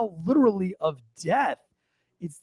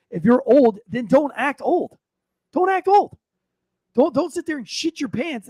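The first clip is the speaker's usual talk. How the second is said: louder and higher, building to a shout; rising rant; 7 semitones higher, 6 dB louder than usual. Real, not acted. Ranting about old politicians.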